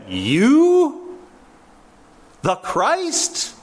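high, scornful "You?" in disbelief; insulting disbelief. Retold or imitated. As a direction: sneer the question at the prisoner. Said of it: "It was an insult".

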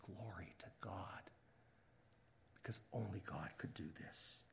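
very soft, low and reverent; hushed awe. Acted. Preacher voices humankind glorifying God, in a hushed near-whisper. Awed praise, dropped to a hush.

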